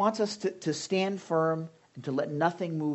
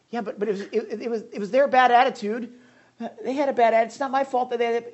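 defensive excuse; 7 semitones higher, 5 dB louder, faster than usual. Retold or imitated. Pastor mocking his excuses.

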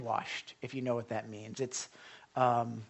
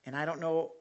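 usual speaking voice

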